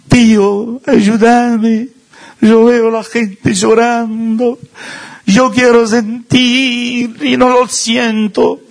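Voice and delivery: put-on high, slow, whiny plea of a teen at a retreat; pleading teenager. Imitating pleading youth at retreat.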